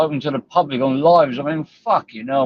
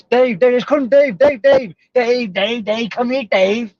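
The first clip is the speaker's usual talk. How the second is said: high, loud, repeated mock cry of Dave; whiny mocking call for Dave, much higher than usual; mocking nagging call; 9 semitones higher, 3 dB louder than usual. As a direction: parody someone pestering for Dave. Mocking someone calling him.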